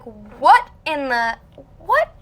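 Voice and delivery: loud, slow mock bafflement; exaggerated incredulous reaction; so confused. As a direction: stretch it out, then jump high on the last word. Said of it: "I was so confused".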